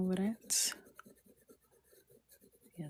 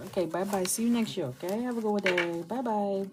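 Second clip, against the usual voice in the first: mock farewell; 4 semitones higher, 4 dB louder than usual. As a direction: pretend cheery sign-off, slightly higher. Mock farewell for leaving viewers.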